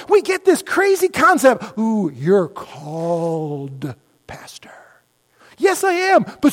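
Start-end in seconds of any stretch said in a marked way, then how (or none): 1.8-4.7 hushed, reverent mock whisper, slow; a church member's awestruck whisper; mock awed whisper. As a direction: breathe it like it's sacred. Mocking the congregation's view of pastors.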